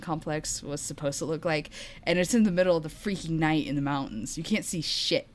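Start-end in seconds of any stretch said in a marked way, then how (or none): none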